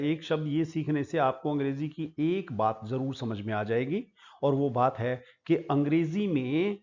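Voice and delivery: ordinary delivery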